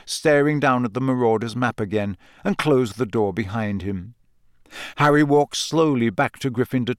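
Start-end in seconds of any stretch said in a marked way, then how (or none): none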